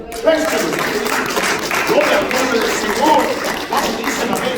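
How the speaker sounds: high, loud preacher's praise call; rousing praise. Real, not acted. Preacher rousing his congregation, high and loud praise. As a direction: lift the church up to glorify the Lord.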